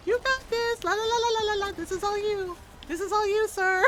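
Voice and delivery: high sing-song brush-off; breezy mock encouragement. Rook ducking out while cheering Lucanus, high sing-song encouragement. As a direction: chirp loudly, refusing to listen.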